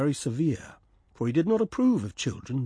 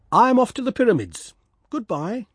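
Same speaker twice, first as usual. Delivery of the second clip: high, louder, fast huffy farewell; swallow voice; 9 semitones higher, 6 dB louder than usual. Acted. Swallow leaving the reed, high huffy goodbye. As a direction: snap it out high and quick in a huff.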